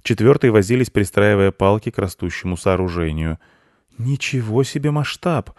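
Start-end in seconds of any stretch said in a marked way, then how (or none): 4.0-5.5 put-on high boyish exclamation of wonder; surprised. A narrator's reading. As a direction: gasp it out in amazement. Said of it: "удивился мальчик". (удивлённо, звонко)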